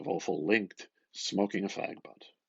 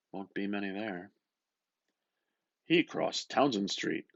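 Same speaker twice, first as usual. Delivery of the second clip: ordinary delivery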